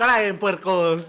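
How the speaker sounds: loud, drawn-out mock call to the pigs; throwing food to pigs. Tossing lunch to greedy classmates like pigs, mock hog call. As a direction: call out slowly as if feeding hogs. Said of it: "Lo aventaba así a los marranos".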